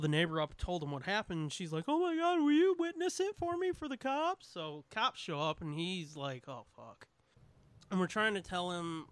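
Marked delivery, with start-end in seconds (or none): 1.9-4.5 high, pleading woman; panicked lady voice. Retold or imitated. 6.5-7.1 low weary groan. Retold or imitated. Voicing the weary cop.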